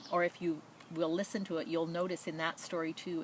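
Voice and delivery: plain reading voice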